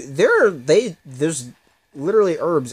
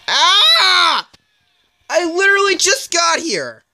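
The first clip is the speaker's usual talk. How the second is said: frustrated shout; 7 dB louder, slower than usual. Real, not acted. Ambushed right after arriving in game.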